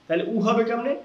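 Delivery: ordinary talk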